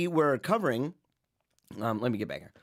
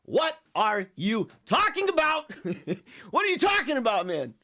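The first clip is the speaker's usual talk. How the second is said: mock disbelief; 6 semitones higher than usual. Host teasing a viewer who asked a silly question, mock incredulous. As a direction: play it outraged, tongue in cheek.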